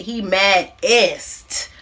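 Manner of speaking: "mad-est" drawn out slowly; stretched for emphasis. Shown as a demonstration. Recapping how mad he got, "mad-est" slowly stretched out. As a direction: draw out "mad-est" to top "madder".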